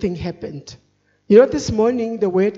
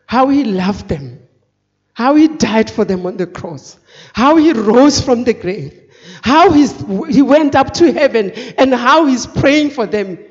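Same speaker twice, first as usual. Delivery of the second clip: impassioned preaching; 6 dB louder than usual. Acted. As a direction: preach it out, each phrase stronger. Testimony building to preaching climax.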